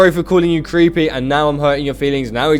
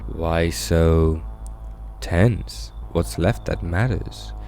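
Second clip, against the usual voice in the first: low, soft, ominous stranger; quiet menace, pitched low; creepy visitor; 7 semitones lower, 6 dB quieter, much slower than usual. Acted. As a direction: probe slowly in a low, eerie voice. Plays the visitor.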